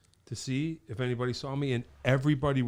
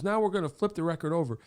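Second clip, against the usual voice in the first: quoting [name]; 9 semitones higher, faster than usual. Retold or imitated. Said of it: "and he goes".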